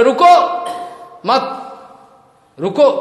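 urgent high shout to stop them; commanding shout. Acted. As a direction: cry out to halt the crowd. Balaram stops villagers jumping in. (चिल्लाकर रोकना)

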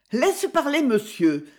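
a pale woman holding herself together; tense, strained. Acted. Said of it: "blanche et crispée". (voix crispée, plus aiguë, contenue)

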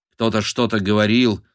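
plain reading voice

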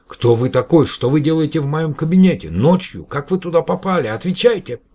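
loud, high, frantic questions; panicked. Acted. Professor panics about a stranger in his study, frantic. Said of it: "голос профессора был совершенно паническим".

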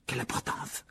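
hushed, offhand reply; dismissive aside. A narrator's reading. Ron and Harry brush off Hermione's worry, soft and dismissive. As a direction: toss it off under your breath. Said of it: "répondirent en chœur Ron et Harry".

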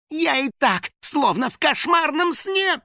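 high, stretched-out howl of distress; Финик wailing. A narrator's reading. Финик swerving into the cornfield, wailing. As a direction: wail it in a high, despairing voice. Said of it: "взвыл Финик".

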